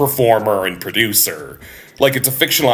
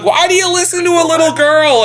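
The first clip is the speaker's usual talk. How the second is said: much higher, louder, hammed-up exasperation; the guests scolding Larry in disbelief; mock exasperated guests; 4 dB louder, faster than usual.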